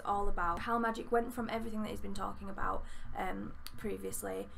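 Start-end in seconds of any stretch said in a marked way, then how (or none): none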